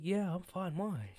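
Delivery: defensive. Retold or imitated. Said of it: "I'm like".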